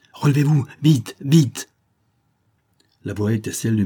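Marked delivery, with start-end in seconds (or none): 0.1-1.7 higher, louder hurried urging; urgent call. Acted. The major urging his fallen man up in the dark, urgent call. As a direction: get him up now, quick.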